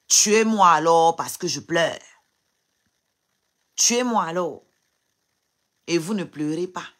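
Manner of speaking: loud, high, furious rhetorical questions; real furious crying rant; tearful rage. Real, not acted. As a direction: hurl the questions at the critics. Crying on live, lashing at commenters.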